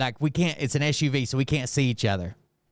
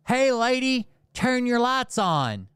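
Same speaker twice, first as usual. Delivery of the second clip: high-pitched call, last words drawn out slow; imitated cop hollering across to a driver; 10 semitones higher, slower than usual. Cop to the lady in the car, calling out.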